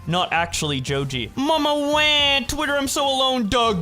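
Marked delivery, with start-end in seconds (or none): 1.3-3.8 mock whining. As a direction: wail it like a lonely kid. Mock whiny lament.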